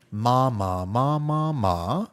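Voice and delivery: ma said five times, each ma on a different tone; five Thai tones. Shown as a demonstration. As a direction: glide ma through the tones one by one. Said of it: "those are five different words".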